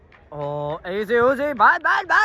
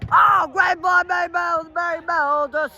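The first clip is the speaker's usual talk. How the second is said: excited shout; 7 semitones higher than usual. Real, not acted. Excited live goal commentary.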